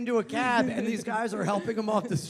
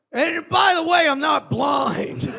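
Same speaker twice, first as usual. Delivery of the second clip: high, drawn-out mock shout; called-out declaration; 6 semitones higher, 5 dB louder, slower than usual. Blind man to the smokers, loudly declaring. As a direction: announce it high, stretching the last words.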